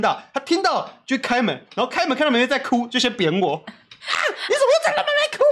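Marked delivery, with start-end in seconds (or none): 3.6-5.4 much higher, laughing mock shout of an angry mom; mock mom yelling. Mother opening the door on crying kids, hammed-up yell.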